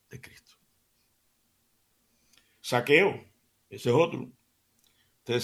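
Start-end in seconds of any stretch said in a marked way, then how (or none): 0.1-0.5 hushed reverent close; whisper. Real, not acted. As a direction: drop to a whisper on the last words. Sermon climax trails into hush.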